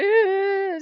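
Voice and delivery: high, loud whimpering wail; a short burst of pretend sobbing; mock crying wail. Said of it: "she'll sit there and cry with you".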